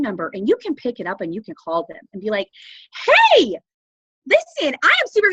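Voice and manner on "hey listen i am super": high, loud, bubbly phone greeting; super excited. Shown as a demonstration. Distributor showing how to call a customer, super excited. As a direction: burst in with big excitement on the call. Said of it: "be super excited".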